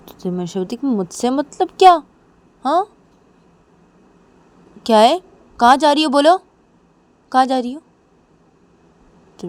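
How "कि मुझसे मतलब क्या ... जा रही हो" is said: loud, high, challenging questions; indignant demand. Acted. Manisha demanding where Nisha is going, indignant. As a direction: fire questions insistently, voice raised. Acted quarrel, demanding an answer.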